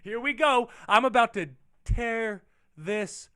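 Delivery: loud, high burst of excitement; high, loud hype, easing into slow, drawn-out words; hyped anticipation. Acted. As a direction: shout it like the big moment has finally arrived. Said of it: "that's like".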